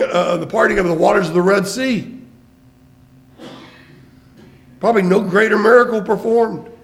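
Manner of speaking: loud, high, driving sermon delivery; booming pulpit proclamation; full-force preaching. Acted. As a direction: preach it out to the back pews. Preacher builds to loud emphasis.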